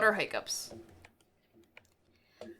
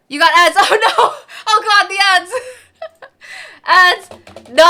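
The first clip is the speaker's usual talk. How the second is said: laughing; 6 semitones higher, 9 dB louder than usual. Real, not acted. Ads interrupt her mid-sentence.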